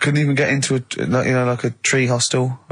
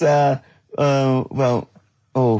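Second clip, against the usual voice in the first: ordinary talk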